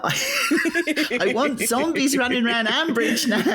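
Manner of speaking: laughing. Real, not acted. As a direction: talk through the giggles. Host laughs at the mashup.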